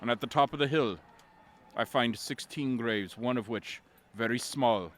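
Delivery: low, measured eerie tale ending; solemn storytelling. A narrator's reading. Bard waking to an abandoned camp and graves, solemn. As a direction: drop low and let the discovery sink in.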